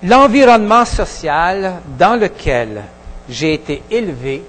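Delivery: normal talking voice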